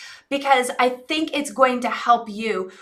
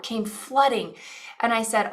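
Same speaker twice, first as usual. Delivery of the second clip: ordinary delivery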